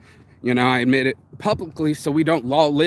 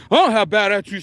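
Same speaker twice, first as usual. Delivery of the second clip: mock yelling; 6 semitones higher, 4 dB louder than usual. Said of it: "starts yelling".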